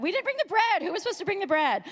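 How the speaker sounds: high, panicked outcry; hammed-up panic over a small disaster; mock freak-out. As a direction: act out frantic blame, pitch way up. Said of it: "I would be freaking out".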